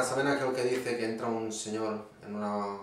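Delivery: ordinary talk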